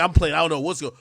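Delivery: usual speaking voice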